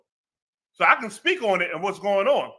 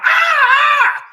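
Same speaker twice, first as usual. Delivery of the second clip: loud, high drawn-out scream; imitated holler; 11 dB louder than usual. Officer retelling a cuffed man's cry, high loud holler. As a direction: holler out like the man being hurt. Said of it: "and he hollered".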